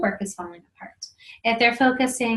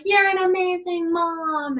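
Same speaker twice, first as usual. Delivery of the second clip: imitating kid; 9 semitones higher, slower than usual. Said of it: "they come back with something like".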